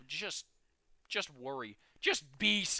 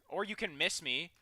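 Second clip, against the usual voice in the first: ordinary delivery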